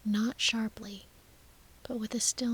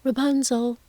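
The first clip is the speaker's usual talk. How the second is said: louder, higher call up to the tower; 4 semitones higher, 10 dB louder than usual. A narrator's reading. Sorceress at the tower's base calls up to Rapunzel, calling out. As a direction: call the name up to someone high above. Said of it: "and call Rapunzel's name".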